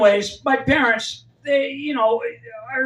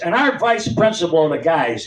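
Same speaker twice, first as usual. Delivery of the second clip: ordinary talk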